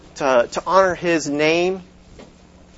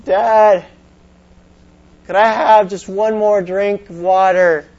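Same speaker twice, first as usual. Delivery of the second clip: put-on boy calling from another room, higher and louder; child's voice pitched up, calling through the house; 4 semitones higher, 4 dB louder than usual. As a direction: call out to dad downstairs. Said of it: "The son cries out from his room".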